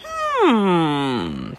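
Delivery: playfully suspicious, savouring a theory; mock intrigued. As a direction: fan teasing a hidden pattern. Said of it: "But I was like".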